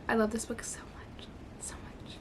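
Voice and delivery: soft breathy whisper; whispered gush. Real, not acted. Reader whispering her love for the book to viewers. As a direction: whisper it like a secret.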